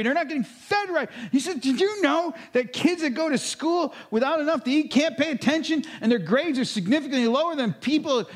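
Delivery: high, loud and urgent retold rant; fired up. Joe to his pastor about hungry kids, fired up and urgent. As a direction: plead hard for the hungry kids. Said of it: "He's just all fired up".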